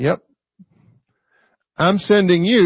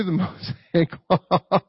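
laughing; 3 semitones lower than usual. Real, not acted. Amused at lawyer testing Jesus.

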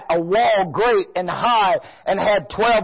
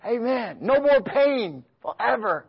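usual speaking voice

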